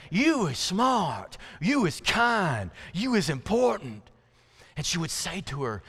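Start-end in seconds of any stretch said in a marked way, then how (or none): 0.1-4.1 put-on higher, drawn-out little girl voice; two-year-old's speech. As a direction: repeat it slowly like a two-year-old. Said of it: "with that weird kind of language of a two-year-old".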